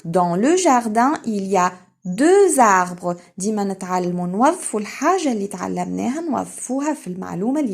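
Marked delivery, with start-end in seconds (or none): 0.0-3.2 slow, louder, clearly articulated; louder, slow teacher model; slow emphatic model. Shown as a demonstration. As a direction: read it slowly for children to repeat. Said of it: "teacher models sentence".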